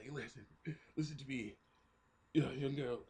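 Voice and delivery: mock weak, halting deathbed words; dying soldier. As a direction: struggle to get words out, play-dying. Acting mortally wounded soldier in hospital.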